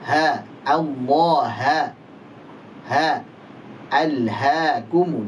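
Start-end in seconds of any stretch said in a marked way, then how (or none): none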